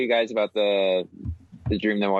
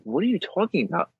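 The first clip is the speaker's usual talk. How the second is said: higher, baffled question; exasperated, puzzled retelling; retold bewilderment; 7 semitones higher than usual. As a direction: act out confusion at nonsense. Retelling his bewildered reply.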